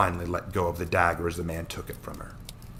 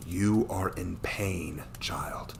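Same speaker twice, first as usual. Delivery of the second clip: slow, soft whisper; a gentle whisper of sympathy; 6 dB quieter, much slower than usual. Acted. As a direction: hush it to a hurting child. Man speaking gently to grieving girl.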